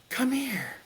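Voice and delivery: high, otherworldly call from far off. Retold or imitated. A divine voice beckoning him to the cave, high and distant. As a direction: call him gently from somewhere beyond. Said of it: "I hear a voice go".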